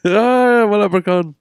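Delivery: high Irish leprechaun voice. Acted. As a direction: squeal it like a cartoon leprechaun. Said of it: "You sound like a leprechaun".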